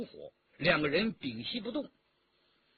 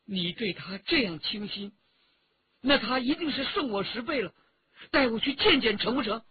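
pushy, wounded, rising higher and quicker; jealous pestering; 5 semitones higher, 4 dB louder than usual. A narrator's reading. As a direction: press jealously, building to an insistent demand. Girl jealously pesters him to meet rival. (酸楚嫉妒，越说越急地追问)

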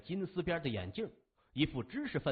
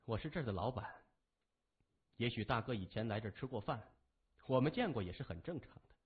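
soft, quick, polite host; smooth it over politely and fast; professional smile; 5 semitones lower, 5 dB quieter, faster than usual. A narrator's reading. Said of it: "露出职业性的微笑说".

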